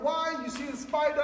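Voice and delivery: high-pitched hammed-up alarm; mock overreaction. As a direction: exaggerate the panic at a spider. Demonstrates a parent overreacting.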